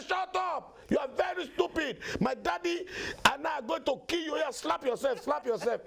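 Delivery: very high, hammed-up child's tirade; bossy son shouting. Son threatening robbers with toy gun, shouting high. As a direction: scold and threaten in a high kid voice.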